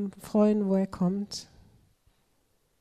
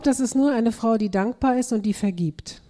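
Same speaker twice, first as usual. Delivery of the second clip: usual speaking voice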